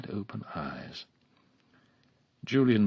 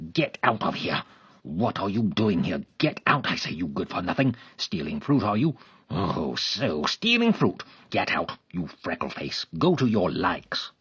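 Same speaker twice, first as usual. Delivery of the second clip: threatening; 6 dB louder than usual. A narrator's reading. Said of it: "venom in his look".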